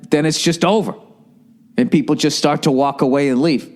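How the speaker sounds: normal talking voice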